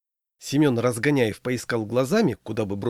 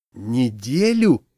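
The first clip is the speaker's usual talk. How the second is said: slow, louder, aghast echo; horrified; 5 dB louder, much slower than usual. A narrator's reading. Friend hearing how short the courtship is, horrified. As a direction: stretch the word out in horror. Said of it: "ужаснулся Семён".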